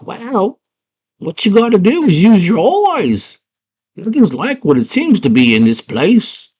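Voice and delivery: high, wise little worm; squeaky worm voice. Retold or imitated. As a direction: advise in a tiny voice. Worm gives advice.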